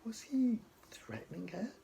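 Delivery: breathless narrator whisper. A narrator's reading. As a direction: whisper it as a secret question. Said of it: "whispered suspense line".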